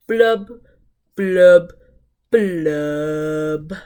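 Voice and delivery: falling glum fish blubs; sad fish blubs. Acted. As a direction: let each blub droop down like a sigh. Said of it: "He's very, very sad".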